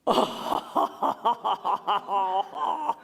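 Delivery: gasping laugh of relief. Shown as a demonstration. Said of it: "this is the laughter of relief".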